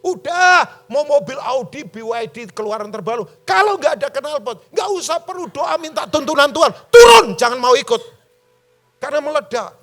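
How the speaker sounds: loud, high heated rant; preacher getting angry at his critical congregation, loud and high; angry preaching. Real, not acted. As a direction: scold the church at full force. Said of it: "Kok saya jadi marah ya?".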